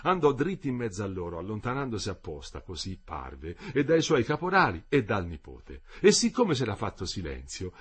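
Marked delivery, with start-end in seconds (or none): none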